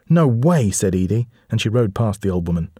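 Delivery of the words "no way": put-on high, loud defiant girl. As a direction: refuse her flatly. Girl defying old woman.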